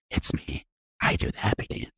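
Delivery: sly secret confession in a near-silent whisper; hushed reveal. Retold or imitated. Commercial punchline pulled close to the microphone, whispered. Leans into mic, reveals himself.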